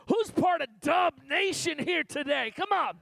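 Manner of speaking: rally the room like a hype man; shouting to the crowd. Real, not acted.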